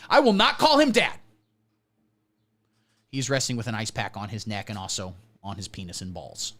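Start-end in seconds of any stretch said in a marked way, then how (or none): 0.1-1.2 higher and louder, "I" punched hard; emphatic. Shown as a demonstration. Host refusing to call him Dad, loud declaration. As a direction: declare it defiantly.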